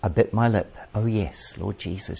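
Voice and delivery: ordinary talk, nothing marked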